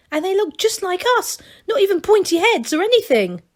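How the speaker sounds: high, eager gnome voice; breathless wonder at the outside; excited young gnome. A narrator's reading. A young gnome tells Masklin about outside, high and eager. Angelo marvels at the nomes.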